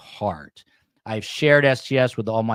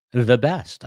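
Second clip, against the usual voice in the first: mock Donald Trump brag, louder and higher; Trump-style boast; 4 semitones higher, 7 dB louder than usual. Host mocking a Trump brag about his coverage, louder. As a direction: pump up the words like a mock Trump rally line. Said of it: "I don't wanna sound like Donald Trump".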